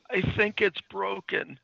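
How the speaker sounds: put-on high-pitched hurt boyfriend voice; mock pained whimper. Podcaster as Freddie after his fall, mock pained high voice. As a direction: whine it as if injured, hammed-up. Acting injured Freddie answering her.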